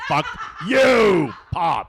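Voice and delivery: loud laughing yell at the screen; laughing shout. Retold or imitated. A dad yelling back at the Joker in the theater, laughing shout. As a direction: bellow it through a laugh.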